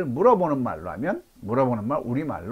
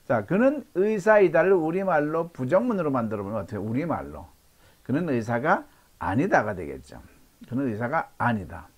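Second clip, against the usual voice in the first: usual speaking voice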